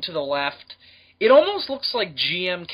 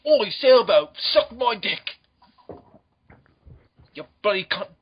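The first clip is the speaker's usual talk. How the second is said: higher, hammed-up Cockney abuse; gruff Cockney; 5 semitones higher, 3 dB louder than usual. Ford abusing a sailboat, gruff Cockney. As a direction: open loud, then drag out the Cockney insults. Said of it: "that gruff, like, Cockney".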